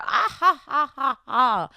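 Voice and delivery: high loud fake flirty giggle; mock giggle. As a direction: cackle like the giggling rival. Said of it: "they're giggling together".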